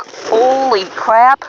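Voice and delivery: high, slow, stunned gasp of words; shocked exclamation. Retold or imitated. Fisherman recalling a giant bluegill, stunned. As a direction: blurt it out in awe at the giant fish.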